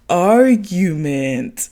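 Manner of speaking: "Argument" drawn out very slowly; say-it-with-me teaching. Retold or imitated. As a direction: stretch "Argument" out for someone to repeat. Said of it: "say it with me".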